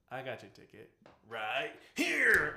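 mock movie-villain menace; menacing line. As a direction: deliver it like a threat, slow. Clerk attacks with menace.

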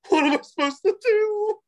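very high, whiny helpless voice; panicked mock cry. Mocking himself stuck alone in the corner, high and whiny. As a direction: squeak it out like a lost kid. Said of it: "They go".

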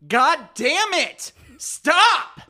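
furious yell at his wife; angry shout. Acted. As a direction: snap at her loudly. Yelling at wife mid-call.

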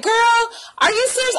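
high-pitched incredulous quote; imitating a baffled sister; disbelieving sister.

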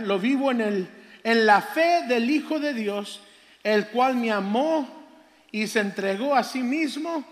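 slow, emphatic scripture declamation; scripture reading. Acted. Preacher reading Galatians to the congregation, solemnly declaimed. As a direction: read the verse out loud and measured, with weight on each phrase.

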